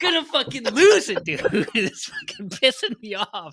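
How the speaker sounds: high, loud frustrated outburst; half laughing, half fed up; exasperated yell. Real, not acted.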